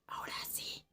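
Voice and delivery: tense whispered cue. Retold or imitated. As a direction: whisper now, barely audible. Omar signals in whisper.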